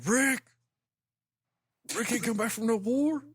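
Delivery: high hammy mock Bernthal; gruff Bernthal impression. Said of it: "John Bernthal's just sitting there like".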